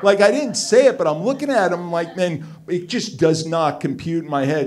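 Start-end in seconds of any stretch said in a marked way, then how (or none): none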